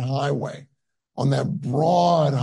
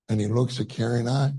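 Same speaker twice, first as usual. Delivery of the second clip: usual speaking voice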